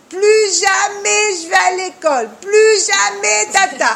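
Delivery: put-on loud, high whining child, dragged out; the son whining to his mother at the car; whiny child. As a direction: play the sulking son refusing school. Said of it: "la discussion qu'on connaît tous les jours".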